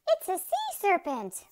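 high, loud scream of alarm. A narrator's reading. Beachgoer spots a sea serpent, screaming. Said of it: "one of them screamed".

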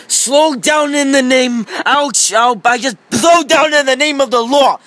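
high, slow mock order then ouch; policeman toy, hurt. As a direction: call the order, then yelp.

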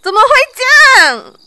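high, broken sobbing question; crying. Acted. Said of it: "别哭了".

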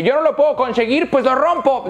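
high, dismissive mock voice; mock vandal logic. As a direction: parody the rioters. (voz burlona de vándalo, aguda)

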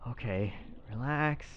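normal talking voice

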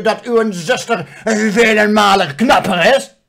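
loud, high, slow scolding mirror; shouting mirror. Retold or imitated. Mirror yells at the princess, loud and high. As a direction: bellow at her like an outraged mirror. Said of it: "En dan zegt die spiegel".

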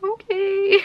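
high sheepish okay; giving in. Retold or imitated. Her giving in to boyfriend, high sheepish okay. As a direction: cave in with a high little okay. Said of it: "I'm like".

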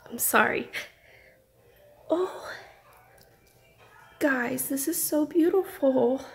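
emotional, near tears; choked up. Real, not acted. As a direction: apologise quietly through welling tears. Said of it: "you're making me tear up".

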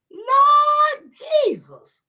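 high, loud, slow Lord Jesus; wailing cry. Real, not acted. Host overwhelmed that the sub was real, high loud wail. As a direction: cry it out to heaven.

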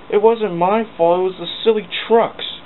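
high, loud defensive excuse; defensive whine. Acted. James blaming the trucks to Sir Topham, high and defensive. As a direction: protest your innocence.

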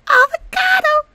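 very high, loud shriek; delighted squeal. Real, not acted. Gamer spotting an avocado toddler outfit, delighted squeal. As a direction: squeal the word with tearful delight.

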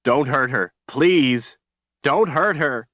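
high, loud, begging plea; desperate pleading. A narrator's reading. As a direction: beg for her life. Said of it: "pleading up to him".

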